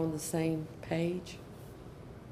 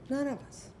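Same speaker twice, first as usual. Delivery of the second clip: higher, softer repeat of "None of us"; soft echo; 7 semitones higher, 6 dB quieter than usual. Real, not acted. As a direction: let "None of us" fall soft, for emphasis.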